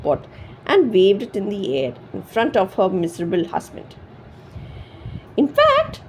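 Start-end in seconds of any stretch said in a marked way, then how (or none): 5.4-6.0 high, loud angry cry; angry shout. A narrator's reading. Wife shouting at her miserable husband. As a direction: shout it at him in fury. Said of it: "she shouted angrily".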